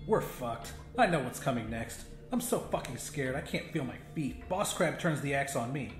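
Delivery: plain reading voice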